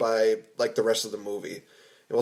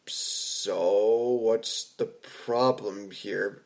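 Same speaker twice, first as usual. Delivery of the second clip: drawn out very slowly, a bit lower; slow, puzzled reaction; 3 semitones lower, 4 dB quieter, much slower than usual. Retold or imitated. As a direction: stretch it out slowly as a puzzled retort. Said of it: "I'm just like".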